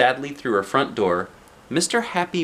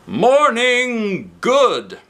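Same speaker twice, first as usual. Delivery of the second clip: much higher, very slow and loud; cheerful; 11 semitones higher, 6 dB louder, much slower than usual. Acted. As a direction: sing out a bright greeting. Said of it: "he said cheerfully".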